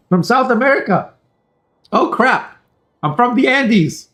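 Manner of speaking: light up at the news; excited discovery. Real, not acted.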